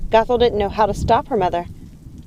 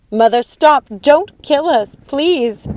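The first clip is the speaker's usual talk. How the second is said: loud, high, frightened begging; panicked, pleading shout; desperate plea; 7 semitones higher, 6 dB louder, much slower than usual. A narrator's reading. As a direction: cry out for your life. Sisters beg for their lives.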